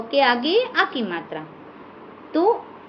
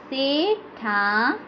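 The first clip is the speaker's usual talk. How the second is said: slow dictation; 4 dB quieter, much slower than usual. Shown as a demonstration. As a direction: stretch out Sethani slowly and softly. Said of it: "आनी". (धीरे खींचकर बोलना)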